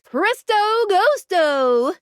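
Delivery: high, slow stage-magic flourish; magician's incantation. Acted. Guide conjures a ghost, magician's incantation. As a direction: draw out the magic words, pitched high.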